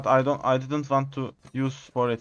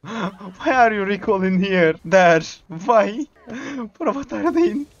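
rising in disbelief at a teammate, higher and louder; what on earth are you doing, bro; baffled outcry; 5 semitones higher, 4 dB louder than usual. Real, not acted. Yelling at a teammate who recalls, incredulous.

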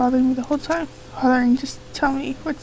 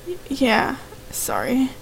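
normal talking voice

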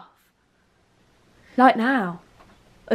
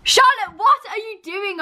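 higher, exasperated call-out; scolding; 7 semitones higher than usual. Real, not acted. Catching her friend having a disco, scolding. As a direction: tell her off, pitched up.

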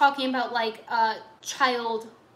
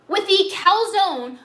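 loud, high, slow disbelief; outraged echo; 6 semitones higher, 8 dB louder, slower than usual. Real, not acted. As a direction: hammer each word in outrage.

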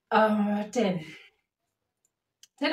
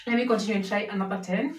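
normal talking voice

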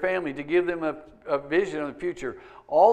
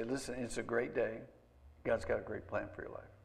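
soft blessing; 5 semitones lower, 11 dB quieter than usual. Retold or imitated. Dad blessing kids at school.

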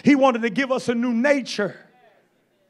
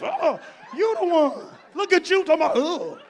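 laughing mock complaint; 5 semitones higher than usual. Mocking a blaming person, laughing.

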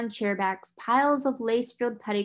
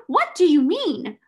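higher, louder alarmed demand; alarmed cry; 7 semitones higher, 7 dB louder than usual. A narrator's reading. Headmistress crying out to the solicitor, higher and louder. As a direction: demand an answer in shock. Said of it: "she cried".

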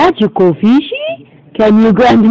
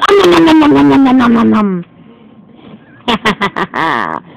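eating noises. Pretend. Doggy eating kitty.

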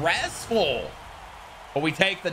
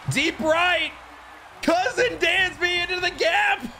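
excited call; 14 semitones higher than usual. Real, not acted. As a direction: call the hit like a thrilled announcer. Calling a deep hit.

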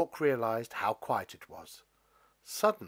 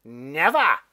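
loud refusal; 3 semitones higher, 14 dB louder than usual. Acted. Stalk refuses Jack.

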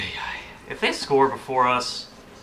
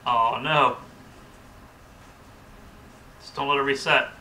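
usual speaking voice

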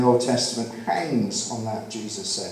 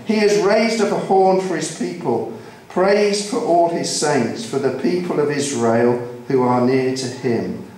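raised, rhythmic scripture reading; psalm proclamation; 3 semitones higher than usual. Real, not acted. Preacher reads Psalm 148 to the congregation, proclaiming. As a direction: call all creation to praise, line by line.

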